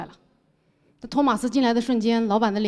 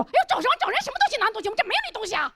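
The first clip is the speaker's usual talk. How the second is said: much higher, brusque shop owner's wife; shrill landlady; 11 semitones higher, faster than usual. Retold or imitated. The owner's wife rushing over to shoo them off, high and brusque. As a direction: fire it off like a pushy shopkeeper's wife. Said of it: "老板娘就飞过来跟我说".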